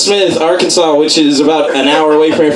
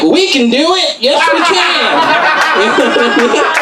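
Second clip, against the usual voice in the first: cheering; 9 semitones higher, much slower than usual. Retold or imitated. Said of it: "he's like".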